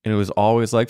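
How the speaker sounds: normal talking voice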